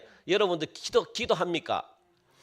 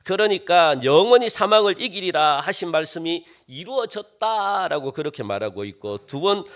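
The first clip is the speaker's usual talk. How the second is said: ordinary talk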